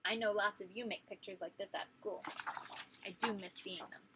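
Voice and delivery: ordinary delivery, nothing marked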